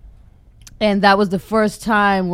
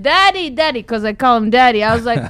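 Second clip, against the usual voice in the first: high, louder pleading to a heavenly Daddy; pleading prayer to God; 7 semitones higher, 4 dB louder than usual. Retold or imitated. Daughter begging God in prayer, high and pleading. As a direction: beg him to help you figure it out, raised and desperate.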